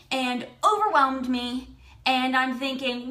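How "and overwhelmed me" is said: ordinary talk, nothing marked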